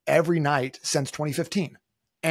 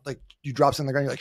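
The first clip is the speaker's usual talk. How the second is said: ordinary talk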